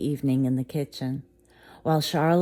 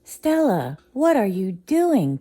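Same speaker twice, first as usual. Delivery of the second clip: higher, louder startled ask; surprised question; 6 semitones higher, 6 dB louder than usual. Acted. Tiana finding the dog in the kitchen, higher and loud. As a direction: react in surprise. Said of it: "Tiana asked".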